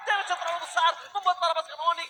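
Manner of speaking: hyped esports play-by-play shout; fighting game announcer. Acted. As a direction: yell the big steal call. Live esports casting climax.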